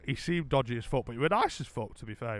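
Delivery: usual speaking voice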